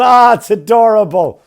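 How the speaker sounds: high, loud, slow gushing over the squirrels; hammed-up baby-talk delight; mock cooing. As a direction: coo like a doting onlooker.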